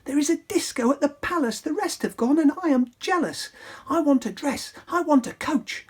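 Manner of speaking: put-on high, pleading girlish princess voice; Cinderella voice. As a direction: whine for the ball in a high voice.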